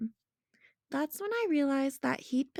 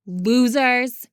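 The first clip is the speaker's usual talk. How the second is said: loud shout; shouted loudly; 10 dB louder than usual. A narrator's reading. Henry shouting after the seventh graders, loud. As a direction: yell it back at the bullies. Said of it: "Henry shouted really loudly".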